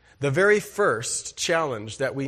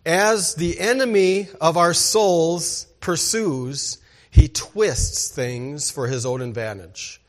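normal talking voice

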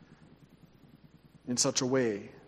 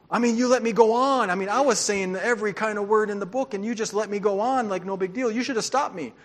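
upset trucker; 6 semitones higher than usual. Retold or imitated. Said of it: "he looks at me, he goes".

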